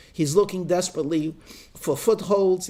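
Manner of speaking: normal talking voice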